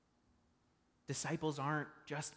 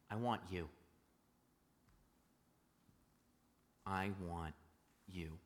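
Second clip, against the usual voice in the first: much lower and softer, intimate; tender invitation; 7 semitones lower, 7 dB quieter than usual. Retold or imitated. God speaking gently to each listener, low and soft. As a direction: say it close, almost to one person. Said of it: "God says the same thing to Moses".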